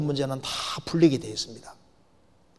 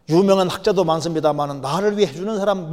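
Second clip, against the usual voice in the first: ordinary delivery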